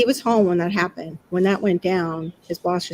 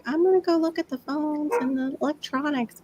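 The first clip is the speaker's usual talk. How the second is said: higher-pitched daughter imitation; lightly imitated daughter; 9 semitones higher, 4 dB quieter than usual. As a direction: voice a daughter's casual announcement, lightly. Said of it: "Daughter's like".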